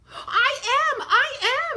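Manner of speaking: high, loud desperate shout; crying out. Acted. Trapped son shouting to his parents, high and loud. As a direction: yell it so they hear you. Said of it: "Sylvester cried".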